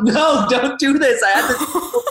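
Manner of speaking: high, slow laughing panic; mock panic at a friend; panicked plea. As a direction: beg her to stop, half laughing. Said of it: "I'm like".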